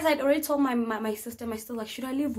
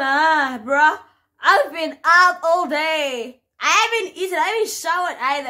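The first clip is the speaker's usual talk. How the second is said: loud, raised-pitch whining protest; exasperated complaint; 5 semitones higher, 9 dB louder than usual. Retold or imitated. Tired cousin to hungry patient demanding food, exasperated complaint. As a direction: complain loudly, dragging out the grievance.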